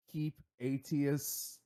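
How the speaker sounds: ordinary talk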